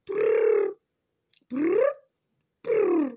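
trilled lips brrr; mock dangling noise. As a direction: buzz your lips like wobbling. Imitating dangling finger sound.

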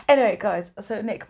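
ordinary talk

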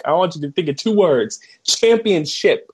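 manic coach impression. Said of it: "So he's like".

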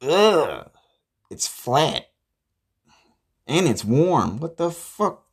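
high, loud cry of revulsion; appalled, high-pitched complaint; disgusted outburst. Acted. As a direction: recoil in exasperated disgust, pitch shooting up. Disgusted by flat, warm drink.

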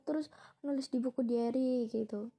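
usual speaking voice

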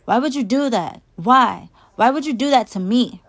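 high, loud anguished demand; child wailing questions at a parent; upset outcry. A narrator's reading. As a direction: cry it out, hurt and accusing. Hurt child confronts her dad.

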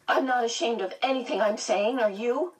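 defiant demand. A narrator's reading. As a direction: throw it back at the principal. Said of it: "Meg demanded".

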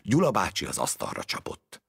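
ordinary delivery, nothing marked

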